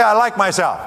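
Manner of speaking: said out high and loud; modelling the line for the audience to repeat; loud affirmation. Shown as a demonstration. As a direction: call it out so the room joins in. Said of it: "So say it. Say".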